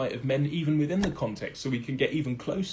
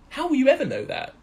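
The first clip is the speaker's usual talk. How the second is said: high-pitched rhetorical challenge; incredulous question; 12 semitones higher, 4 dB louder than usual. Real, not acted. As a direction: leap up high in disbelief. Rhetorical challenge about unknowable intention.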